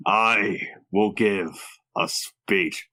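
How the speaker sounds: low, slow, halting hero voice; ponderous Optimus with big pauses; mock heroic speech. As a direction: drag it out solemnly, pitched low. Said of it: "give Optimus Prime a heroic speech".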